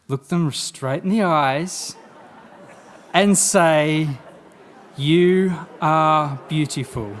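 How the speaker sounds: slow, loud, every word leaned on; slow emphatic. Shown as a demonstration. Speaker showing the audience how to tell a neighbour, slow and loud. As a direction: say it slowly and mean it. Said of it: "look at the person next to you".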